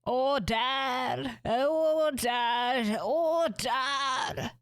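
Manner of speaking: bang the gavel and shout; shouting order. Acted. Judge calling court to order.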